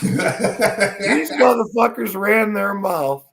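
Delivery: high, slow words through laughter; laughing. Real, not acted. Telling the bowling alley fight, laughing. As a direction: push the line out while cracking up.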